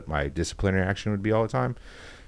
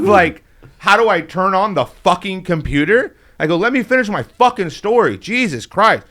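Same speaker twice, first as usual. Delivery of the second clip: high, loud retold outburst; loud, high-pitched indignant tirade, first words drawn out; indignant rant; 12 semitones higher, 7 dB louder, slower than usual. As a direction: blow up at a teacher in exasperation. Retold angry outburst at the teacher.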